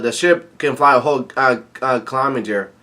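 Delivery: normal talking voice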